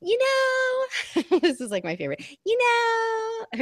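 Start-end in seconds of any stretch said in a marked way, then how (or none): 0.0-1.2 very high, put-on news anchor voice; mock newscaster voice. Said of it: "should I go into the other voice". 2.4-3.6 very high, put-on news anchor voice; mock newscaster voice. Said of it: "should I go into the other voice".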